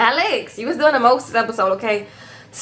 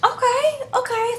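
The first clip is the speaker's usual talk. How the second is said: high, strained okay, okay; a mother faking calm, pitched way up; forced acceptance; 13 semitones higher than usual. Retold or imitated. Mom forcing herself to accept the news, high strained voice. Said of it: "his mom was like".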